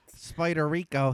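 Spider said as "spaɪtə", Philly style; Philly pronunciation. Shown as a demonstration. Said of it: "told you pronounce these words wrong".

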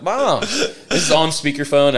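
high mock whine at Mom; mortified protest. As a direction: protest like an embarrassed kid. Embarrassed kid protests on speakerphone.

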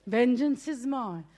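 lightly voiced God; solemn divine voice. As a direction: intone it as God. Repeats the verse solemnly.

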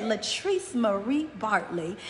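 low, hushed, slow full name; stern Southern mama calling you by your whole name; mother's Southern elegance. Retold or imitated. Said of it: "Faye put that Southern flair on".

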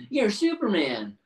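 high, loud gasp of discovery; amazed realization. Retold or imitated. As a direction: say it like the secret just clicked. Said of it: "And she's like".

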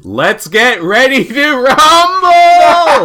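high, loud, slow ring announcer roar; fighting game announcer. Pretend. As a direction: stretch it out like a boxing announcer.

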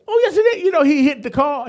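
telling the church what he did; high, loud, incredulous; excited retelling. Retold or imitated. Retelling the crash, pitch leaps.

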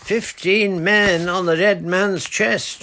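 loud, slow, high pirate chant; a boy hopping on a crutch, shouting a pirate song; shouted sea song. A narrator's reading. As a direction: bellow the shanty, loving it. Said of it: "shouted Roger".

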